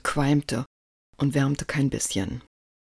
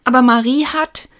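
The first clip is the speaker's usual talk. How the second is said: high, loud outraged protest; raised-voice indignant objection; 10 semitones higher, 10 dB louder than usual. A narrator's reading. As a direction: object hotly like a wronged child. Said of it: "begann Lukas empört".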